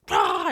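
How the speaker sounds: loud, rough roar of frustration; mock angry roar. Mom showing how she didn't react, mock roar. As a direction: roar like a parent blowing up, acted. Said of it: "instead of just being like".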